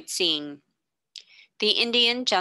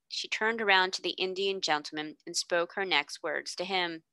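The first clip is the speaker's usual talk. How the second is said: plain reading voice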